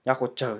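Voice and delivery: "Nyako chou" with ny onset, ch in "chou"; green dialect pronunciation. Shown as a demonstration. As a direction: repeat "Nyako chou" clearly as green Hmong. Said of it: "Whereas the green dialect".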